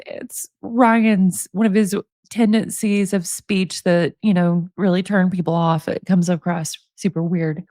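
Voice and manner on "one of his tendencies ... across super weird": ordinary talk, nothing marked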